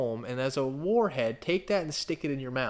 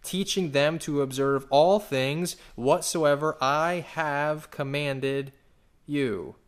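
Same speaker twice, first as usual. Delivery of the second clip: scripture reading; 5 dB louder than usual. Acted. Preacher reading scripture to the congregation, solemn. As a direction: read the commission with solemn weight.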